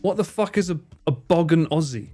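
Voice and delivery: usual speaking voice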